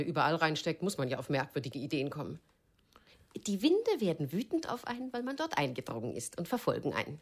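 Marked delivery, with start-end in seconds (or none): 3.5-7.2 höher einsetzend, höhnisch nachäffen; spöttisch. Acted. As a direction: eine Spukgeschichte ins Lächerliche ziehen. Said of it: "mach dich nicht lustig über ihn". (Clarice macht sich vor Herb über Ellens Angst lustig, höhnisch)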